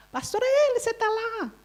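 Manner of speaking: high-pitched, soft mock calling voice; high little voice checking someone is there; imitated caller. Acts out a woman calling her.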